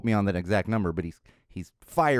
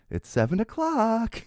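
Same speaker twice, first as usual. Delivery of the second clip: slow reminder; 12 semitones higher, slower than usual. Retold or imitated. Friends announcing the alarm.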